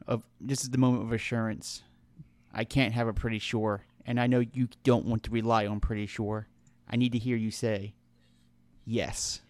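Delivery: low, soft, earnest game character; grave, hushed in-character appeal; Roscoe. Acted. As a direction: press quietly for a firm answer. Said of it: "Roscoe kneels down to you and goes".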